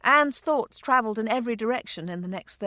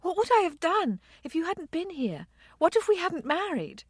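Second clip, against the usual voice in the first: high-pitched, anxious questioning; pleading, pitched-up worry; urgent distress; 9 semitones higher than usual. A narrator's reading. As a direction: blurt it out in rising panic. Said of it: "grasping his coat front with urgency".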